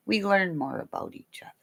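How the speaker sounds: quick intimate whisper; soft unvoiced whisper. Real, not acted. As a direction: lean in and whisper it fast. Whispered aside to viewers.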